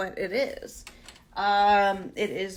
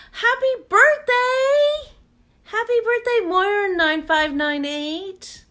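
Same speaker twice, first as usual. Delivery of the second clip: much higher and louder, slow and stretched out; excited birthday shout-out; 11 semitones higher, 6 dB louder, slower than usual. Real, not acted. To a viewer on her birthday, a high, loud, stretched-out cheer. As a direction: sing out the birthday wish like a cheer.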